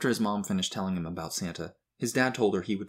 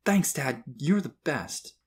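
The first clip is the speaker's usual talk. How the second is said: put-on higher, bouncy child's voice; excited; 6 semitones higher, 3 dB louder, slower than usual. A narrator's reading. Boy thanking dad, jumping excitedly. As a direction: gush thanks like a thrilled kid. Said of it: "jumping up and down excitedly".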